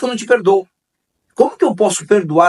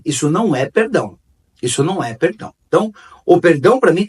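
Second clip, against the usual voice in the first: usual speaking voice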